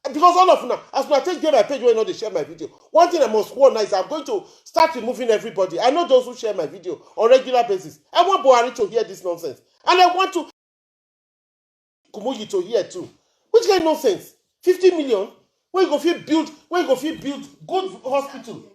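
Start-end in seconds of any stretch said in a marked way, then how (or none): 0.0-13.1 loud, angry tirade; real fury poured out. Real, not acted. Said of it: "I'm angry now". 13.5-16.9 angry. Real, not acted. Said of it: "I'm angry now".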